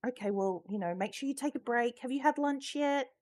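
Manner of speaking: a gentle, fussing lady; kind woman. Retold or imitated. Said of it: "she was just like".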